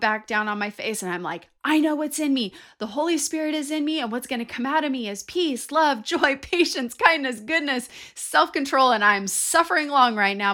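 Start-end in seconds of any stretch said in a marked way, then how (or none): 1.6-8.9 higher and louder, pressing each word out; fervent declaring prayer. Retold or imitated. Desperate prayer on the floor. 8.9-10.5 louder, pushing through; fervent loud plea; loud pleading prayer. Retold or imitated. Desperate prayer on the floor.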